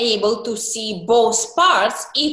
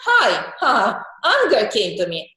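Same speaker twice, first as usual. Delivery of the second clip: usual speaking voice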